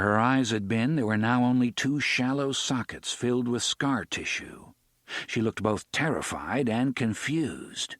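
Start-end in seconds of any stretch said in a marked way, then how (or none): none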